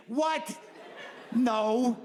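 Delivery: high disbelieving yelp; incredulous. Acted. Incredulous reaction.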